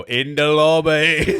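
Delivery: high, loud, stretched British voice; mock British accent. Parroting it to get a laugh, mock British accent. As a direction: sing it out in a hammed-up British accent. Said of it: "doing it in a British accent".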